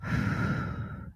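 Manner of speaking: soft breath out in the pause; quiet sigh; audible breath. Shown as a demonstration. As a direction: breathe into the silence. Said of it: "using the breath in the pause".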